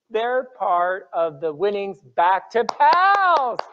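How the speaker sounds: ordinary delivery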